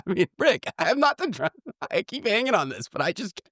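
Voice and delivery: very high, squeaky laughing plea; helpless laughter. Real, not acted. Host asks co-host what else to do, laughing helplessly. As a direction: plead while cracking up.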